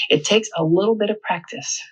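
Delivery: usual speaking voice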